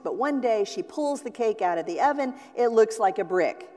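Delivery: ordinary talk, nothing marked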